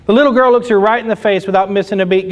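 usual speaking voice

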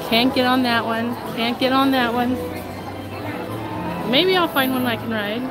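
ordinary talk

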